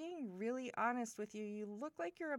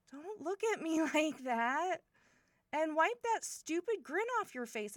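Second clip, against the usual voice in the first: loud, higher-pitched telling-off; flustered scolding; 6 semitones higher, 6 dB louder than usual. Acted. Telling brother's friend to stop grinning, flustered scolding. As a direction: scold him for teasing you.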